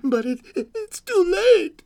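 put-on stammer, then wail like a small child; distressed little girl. A narrator's reading. Little girl's distressed protest continues.

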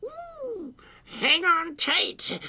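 high, slow, stretched creature cry. A narrator's reading. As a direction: draw it out high as the cat takes off. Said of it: "cried the creature".

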